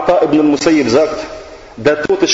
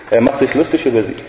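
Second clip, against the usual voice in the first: usual speaking voice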